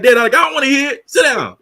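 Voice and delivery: imitating his kid talking back to Dad. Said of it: "They be like".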